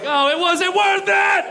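high, slow wailing cry; mock dying wail; anguished shout. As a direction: scream it as the truck hits. Life flashing before crash.

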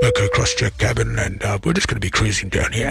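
low, drawn-back pilot on the intercom; pilot cabin announcement. Acted. Radio host mimics the pilot interrupting a movie, low captain voice. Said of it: "at this point you hear".

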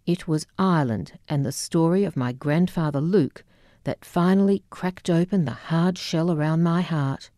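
ordinary delivery, nothing marked

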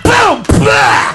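loud boom then splat; gunshot noise. Acted. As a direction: blast then wet splat. Gun in mouth sound effect.

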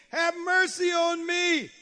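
high, loud sustained plea; preacher voicing a desperate call; crying out. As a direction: cry out for mercy from the pulpit. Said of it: "and cried unto him".

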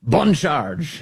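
Bon Charge with a light French accent, rising; French-flavoured Bon Charge, playful. Shown as a demonstration. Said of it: "a slight French accent".

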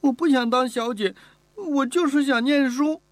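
put-on stubborn girl. Girl insisting to the mistress she wants school, stubborn. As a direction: insist with all she has.

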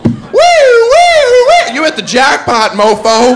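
very high, loud siren wail; car alarm siren. Pretend. Imitating car alarm and jackpot.

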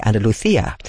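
"Andalucia" in Castilian Spanish, c as th, an-da-lu-THEE-a; imitating a Castilian Spanish speaker's "Andalucia"; Castilian Spanish accent. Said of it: "where he would say".

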